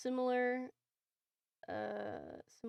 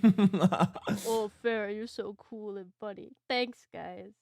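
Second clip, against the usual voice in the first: fan voice; 8 dB louder, slower than usual. Pretend. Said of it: "You gotta do our voices and responses".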